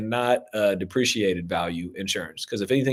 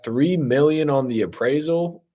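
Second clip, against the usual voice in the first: normal talking voice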